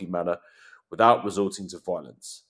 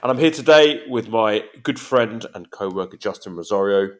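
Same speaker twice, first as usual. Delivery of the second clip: ordinary talk, nothing marked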